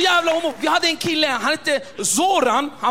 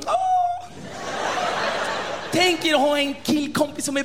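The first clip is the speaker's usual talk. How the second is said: put-on girly swoon. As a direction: squeal like a smitten girl.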